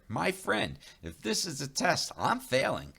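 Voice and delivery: high, mock-desperate plea; exasperated. Narrator pleading with Running Bear, high and exasperated. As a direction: throw up your hands.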